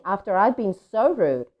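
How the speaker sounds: usual speaking voice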